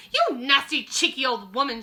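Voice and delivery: shouted. Acted. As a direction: spit the insult at her. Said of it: "shouted Mister Jenkins".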